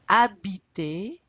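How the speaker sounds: "habiter" with the H left silent. Shown as a demonstration. Teacher drilling verbs for repeat-after-me, "habiter" with silent H. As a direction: say "habiter" starting straight on the A, no H. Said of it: "you don't pronounce the H".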